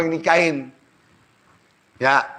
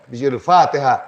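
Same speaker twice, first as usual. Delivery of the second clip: usual speaking voice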